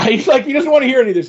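laughing. Real, not acted.

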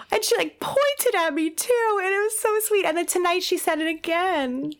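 high-pitched, gushing squeal of a voice; overcome with delight. Real, not acted. Mother tells co-host about her toddler, gushing high. Moved mother recounts first I-love-you.

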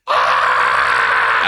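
low, loud mock "Ah!" scream. Wife seeing the snake again, mock scream. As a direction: let out a hammed-up yelp. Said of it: "That's exactly how it goes".

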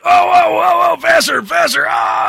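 high, loud pained cries of panic; pained yelps. Acted. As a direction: yelp in pain as the wrist bends. Keon pinned, wrist about to break.

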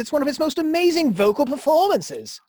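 high-pitched, protesting disbelief; indignant defense. Acted. Host defending Led Zeppelin to his co-host, indignant and high. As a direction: rise up defending his band.

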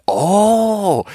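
high, drawn-out, loudish "oh" imitating a crowd; mock delighted group reaction. Six diners reacting to his being Swedish, mock-impressed. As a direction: swoop up high as if hugely impressed. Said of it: "they're all like".

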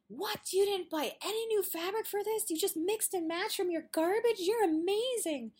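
a quilter who can't believe it, delighted; squealing amazement. Retold or imitated. Quilters amazed at a stash-only quilt, high thrilled squeal. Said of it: "people who quilt are like".

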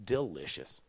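ordinary delivery

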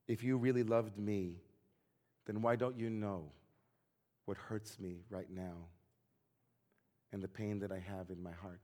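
low, soft, slowing plea; quiet hurt. Retold or imitated. Ivan tells his best friend of hidden pain, quietly hurt. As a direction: let it sink to a pained near-murmur.

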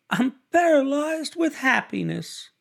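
high, breathless delight; a young woman overcome with joy; gushing Daisy. A narrator's reading. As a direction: gush it out in a high voice. Daisy greets Nick laughing.

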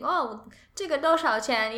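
high, pleased 这个多少钱; proud beginner. Retold or imitated. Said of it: "you'll be so proud".

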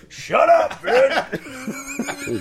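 high, loud shout through laughter; laughing mock yell. Replaying the audience heckle at the woman, laughing. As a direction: yell it while cracking up.

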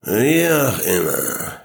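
put-on slow drunken stammer. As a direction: stumble over the words, drunk. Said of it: "stammelte ihr Vater".

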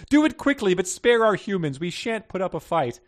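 very high, loud frightened puppy voice; tearful plea. A narrator's reading. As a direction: plead bravely through mock tears. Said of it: "tears flowing from her eyes".

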